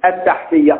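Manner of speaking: ordinary talk, nothing marked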